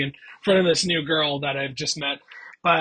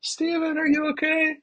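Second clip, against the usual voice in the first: much higher, sweet concerned voice; worried girlfriend; 12 semitones higher than usual. Retold or imitated. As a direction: pitch way up like a worried girl at the door. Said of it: "she goes".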